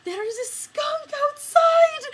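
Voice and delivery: high, loud and slow panicked cry; teacher shouting about the skunk in French; alarmed shout. Retold or imitated. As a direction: announce the danger in a fright. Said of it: "and she's like".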